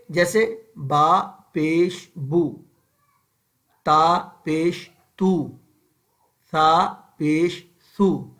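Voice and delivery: "با پیش بو" slow with lips rounded half-closed; slow short-u drill. Shown as a demonstration. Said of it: "ہونٹوں کو گول ناتمام بند کر کے".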